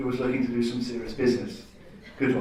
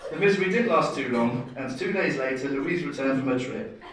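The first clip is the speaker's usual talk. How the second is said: ordinary delivery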